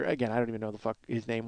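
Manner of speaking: normal talking voice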